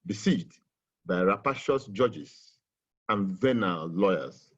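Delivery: "Besieged by rapacious judges and venal lawyers" slowed and weighted word by word; emphasized repeat. Shown as a demonstration. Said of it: "I like to emphasize that".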